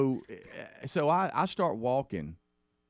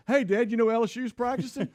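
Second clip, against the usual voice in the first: put-on higher excited kid's voice; excited son; 12 semitones higher, 3 dB louder than usual.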